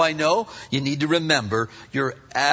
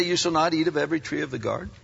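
quick sneering question; 3 semitones lower, 4 dB quieter, much faster than usual. Retold or imitated. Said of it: "Did God say".

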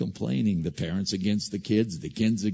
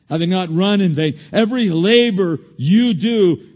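preaching; 11 semitones higher, 12 dB louder than usual. Acted. Preacher crying out scripture to the congregation, loud. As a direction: drive the scripture home.

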